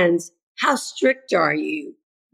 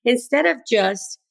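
normal talking voice

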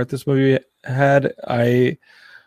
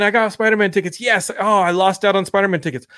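mock fan tweets; 6 semitones higher, faster than usual. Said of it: "people saying".

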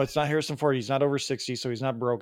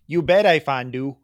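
put-on Peggy Carter accent; 7 dB louder than usual. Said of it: "I did the Peggy Carter accent wrong".

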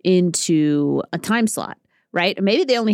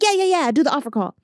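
higher, dismissive yeah-yeah brush-off; mock dismissive staff; 9 semitones higher, faster than usual. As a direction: wave her off in a pitched-up voice. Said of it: "And they're like".

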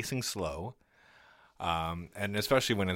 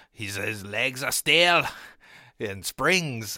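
bad Australian accent, slow and louder; hammy Australian accent, a bit higher; 4 semitones higher, 7 dB louder, slower than usual. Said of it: "you actually do a worse Australian accent".

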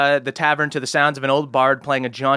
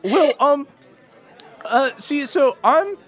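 put-on higher, stammering nervous young voice; nervous halfling boy; 11 semitones higher than usual. Halfling scoutmaster pleading with a hero, high and jittery. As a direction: blurt it out like a scared scout leader.

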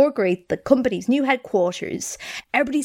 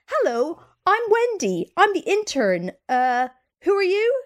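lightly voiced eager intern, higher pitched; chirpy self-introduction; 8 semitones higher than usual. Intern barging into an executive's office, mock-chirpy introduction. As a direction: brightly announce yourself, with a lilt.

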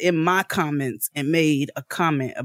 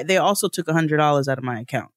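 ordinary talk

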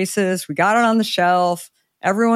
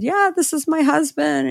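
eager explaining; 10 semitones higher than usual. Retold or imitated. Explaining to store guy, retold.

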